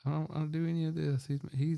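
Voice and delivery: high, pleading voice; imitating a desperate prisoner begging the lawyer. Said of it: "He's, like, begging".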